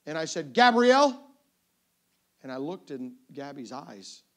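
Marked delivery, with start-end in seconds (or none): 0.5-1.2 loud, raised, sharp name call; frustrated dad. Retold or imitated. Frustrated dad about to rebuke daughter, sharp call. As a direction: scold a child by full name.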